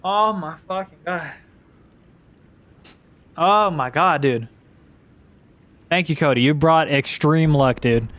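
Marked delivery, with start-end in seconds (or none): none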